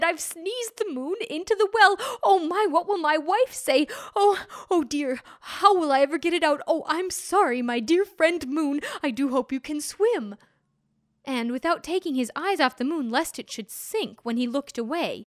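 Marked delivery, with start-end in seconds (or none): 0.0-10.4 higher, louder, fretting panic; flustered alarm. Acted. Man apologising to the moon, flustered and alarmed. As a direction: babble in dismay. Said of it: "with a cry of alarm".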